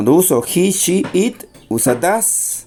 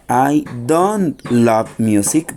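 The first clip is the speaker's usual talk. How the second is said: slow model sentence; much slower than usual. Shown as a demonstration. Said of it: "Entonces sería I love music".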